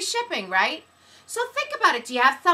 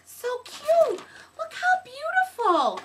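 cooing; 8 semitones higher than usual. Real, not acted. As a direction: coo over something cute. Cooing over package.

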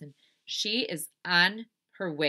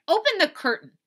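high, loud command; Eugenia Lincoln; 13 semitones higher, 10 dB louder than usual. Acted. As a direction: order a sister about. Bossy sister giving order.

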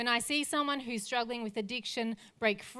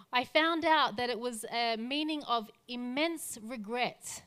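ordinary talk

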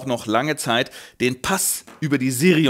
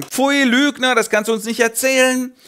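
indignant refusal; 10 semitones higher, 3 dB louder than usual. Acted. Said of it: "Aber die Edain sagen".

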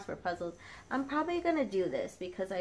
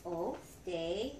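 ordinary delivery

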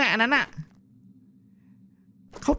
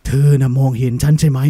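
eerie ghost whisper; 12 semitones lower, 7 dB louder than usual. Acted. ผีสาวถามว่าเห็นไหม.

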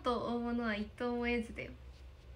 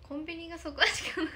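usual speaking voice

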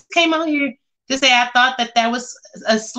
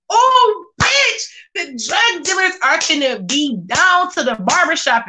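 excited gossip; 7 semitones higher than usual. Real, not acted. Telling the chat the dealers will be at the barbershop, thrilled. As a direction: explode with gleeful gossip.

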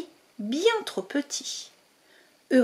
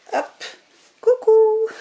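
playful peekaboo; 11 semitones higher, 7 dB louder, slower than usual. Acted. Peekaboo through the hole.

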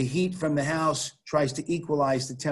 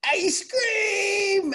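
put-on high little girl yelling for ice cream; imitating a child's shout; child's excited shout; 4 dB louder, much slower than usual. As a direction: squeal it like a thrilled small kid. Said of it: "she'd be like".

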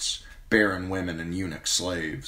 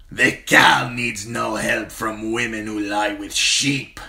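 slow, loud, higher shout; barked; 5 semitones higher, 8 dB louder, slower than usual. Acted. Crude rider barks at the captive woman, loud contempt. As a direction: bark it with contempt. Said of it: "barked Kotho".

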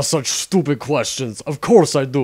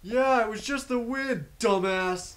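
much softer, snide muttered aside; sarcastic mutter; 6 semitones higher, 11 dB quieter than usual. Real, not acted. Henchman mocks his boss out of earshot, much softer. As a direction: insult him under your breath. Muttered insult behind boss's back.

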